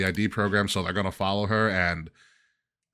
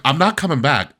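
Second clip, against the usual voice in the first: mock refusal; 6 semitones higher, 7 dB louder than usual. Playing Paul Heyman refusing to return.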